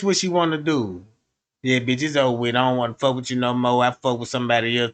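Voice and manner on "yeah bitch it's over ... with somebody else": much lower, fast brush-off; low dismissive breakup. Retold or imitated. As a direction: toss off the breakup low and quick. Girlfriend breaking up.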